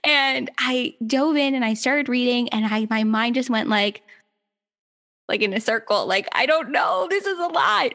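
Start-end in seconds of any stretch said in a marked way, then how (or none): 6.3-7.9 high-pitched mock overwhelm; retold overwhelm. Guest recalling her mind going in circles, overwhelmed. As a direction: pitch it up as if it is all too much. Retells being overwhelmed while reading.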